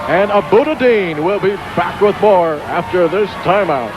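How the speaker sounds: high, fast ringside call of the match; excited play-by-play. Real, not acted. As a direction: call every move like a ringside announcer.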